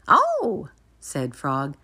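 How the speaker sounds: very high Frog voice; high-pitched, approving little Frog; Frog, impressed. A narrator's reading. Frog admiring Toad's list, high-pitched and impressed. Reads Frog's line in story.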